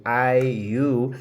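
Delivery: ordinary talk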